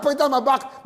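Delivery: ordinary talk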